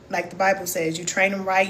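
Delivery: ordinary talk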